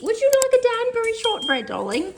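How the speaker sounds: high, soft sing-song coo to the dog; high dog-talk voice. Real, not acted. Owner coaxing her dog to sit, high cooing baby talk.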